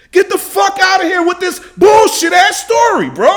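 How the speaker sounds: real furious yelling; furious shout. Real, not acted. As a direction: scream it, outraged. Furious rant at the interview clip.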